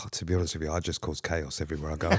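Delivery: low, soft smooth-talker voice; lady-killer murmur; deep seductive voice. Pretend. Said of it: "continuing sexy Pete voice".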